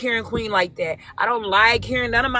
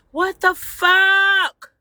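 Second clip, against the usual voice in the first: slow, higher, drawn-out what the fuck; shocked; 7 semitones higher, 3 dB louder, much slower than usual. Real, not acted. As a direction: stretch it out in disbelief. Hears friends arguing, shocked.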